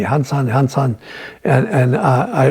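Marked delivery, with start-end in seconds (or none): none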